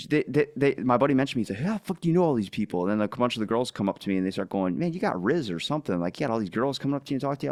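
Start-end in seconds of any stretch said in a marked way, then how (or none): 1.7-2.8 fast, higher mock amazement; imitating a stunned friend; amazed buddy. 4.8-7.5 fast, higher mock girls' voice; lightly voiced girls; teasing girls. Girls quoted teasing him.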